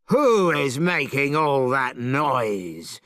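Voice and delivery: slow, a touch of a roaring monster; angry dragon. Acted. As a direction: growl it out as a big beast. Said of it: "it shouted angrily".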